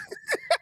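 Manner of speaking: very high, breathless burst of laughter; squealing laugh. Real, not acted. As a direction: let a shrieking laugh escape. Laughing hard with co-host.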